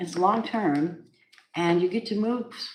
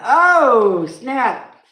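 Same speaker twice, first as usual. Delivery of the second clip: excited exclamation; 7 semitones higher, 10 dB louder than usual. Real, not acted. Excited reaction to a card.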